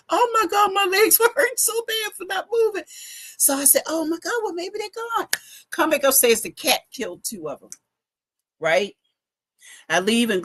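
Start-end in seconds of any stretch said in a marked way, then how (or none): 0.1-5.3 high exasperated. Retold or imitated. Legs hurt from standing still.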